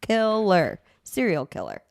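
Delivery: normal talking voice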